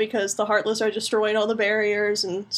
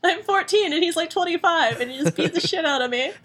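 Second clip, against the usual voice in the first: Sora voice; 6 semitones higher than usual. Retold or imitated. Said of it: "She's like".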